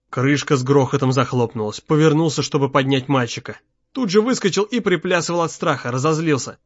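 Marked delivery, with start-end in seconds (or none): none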